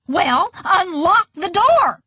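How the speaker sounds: high, drawn-out mild scream; exasperated scream. A narrator's reading. Grandma screaming at waitress while playing, exasperated. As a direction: holler an impatient order. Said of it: "screamed Grandma Evelyn".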